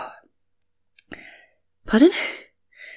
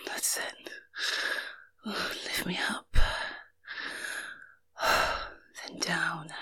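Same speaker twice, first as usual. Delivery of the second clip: soft, breathy whispered guidance; 7 dB quieter than usual. Acted. Woman guiding her lover, breathy whisper. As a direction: guide him in whispers.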